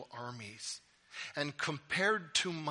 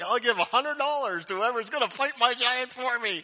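high, loud mock offer; hammed-up plea for someone else to step in; desperate Saul; 10 semitones higher, 9 dB louder than usual. Saul offering cash so he needn't fight, comically desperate.

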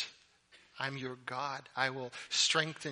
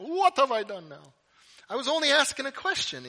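loud, high, hammed-up Italian accent; 11 semitones higher, 9 dB louder than usual. David replying to his scolding brother, Italian accent. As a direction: protest innocence in a mock Italian accent. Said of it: "say this with an Italian accent".

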